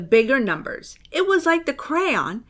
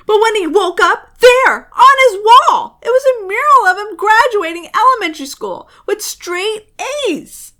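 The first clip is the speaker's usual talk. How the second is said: high, loud panicked telling; rising panic; alarmed; 9 semitones higher, 9 dB louder than usual. A narrator's reading. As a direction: rush in shocked alarm. Building panic.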